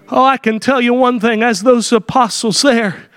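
ordinary talk

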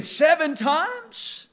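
high, slow disbelieving "Seven times?"; incredulous question. Acted.

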